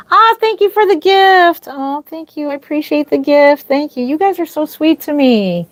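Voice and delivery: high, gushing gratitude; thrilled by the gift; delighted thanks. Real, not acted.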